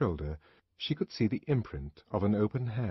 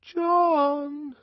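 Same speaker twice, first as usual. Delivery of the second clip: small ingratiating call; 5 dB louder than usual. A narrator's reading. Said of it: "a small, ingratiating voice".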